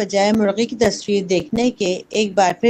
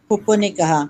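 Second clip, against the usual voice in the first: usual speaking voice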